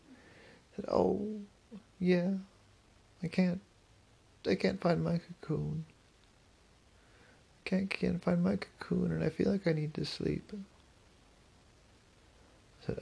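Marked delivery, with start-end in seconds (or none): 0.9-10.5 a touch higher, worried little voice; a small creature who can't find home; lightly raised butterfly voice, fretting; lightly voiced butterfly. A narrator's reading. Butterfly answers Ollie.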